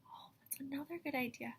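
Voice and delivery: soft unvoiced whisper to the class. Real, not acted. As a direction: hush it like a secret.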